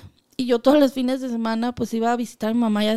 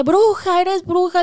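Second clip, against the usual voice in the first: amazed accusation; 5 semitones higher, 4 dB louder than usual. Retold or imitated. Mother greets her, amazed.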